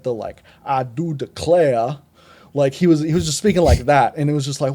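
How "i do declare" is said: put-on slow, drawn-out Alabama Southern accent; mock the Joker's thick Alabama Southern accent. Reviewer mocking the Joker's courtroom speech, in a slow Alabama Southern accent. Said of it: "like, Alabama accent".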